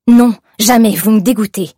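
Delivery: put-on young woman loudly refusing her harasser's bargain; disgusted refusal. A narrator's reading. Refusing the clerk's kiss.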